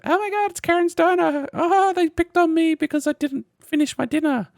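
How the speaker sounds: high, loud squealing customer voice; mock customer. Mocking dumb customers, squealing high and loud. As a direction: squeal it like a dumb TikTok diner. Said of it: "people that have been like".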